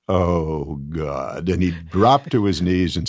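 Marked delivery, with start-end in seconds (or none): 0.1-1.5 low, sinking realization; retold defeated groan. [name] realises he was fooled, mock defeat. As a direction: let it drop away, acting beaten.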